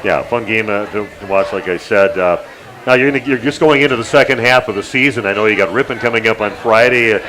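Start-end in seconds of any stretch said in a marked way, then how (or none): none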